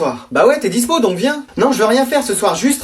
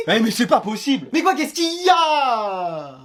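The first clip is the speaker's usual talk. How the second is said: drawn-out wail of despair; exasperated; 5 dB quieter, slower than usual.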